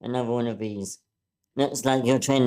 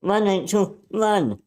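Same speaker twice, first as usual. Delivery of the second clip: urgent shout; 9 semitones higher, slower than usual. Acted. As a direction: shout her away from danger. Anne urges Rachel to flee.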